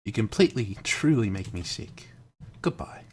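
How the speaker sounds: a lightly put-on British accent, high and pleading at the start. British accent as the club president pleading with the player. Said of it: "I don't have to do a British accent anymore".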